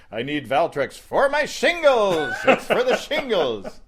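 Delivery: high, loud protest that builds; loud embarrassed insistence. Retold or imitated. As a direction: announce it defensively to the whole pharmacy. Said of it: "walked into the pharmacist and said".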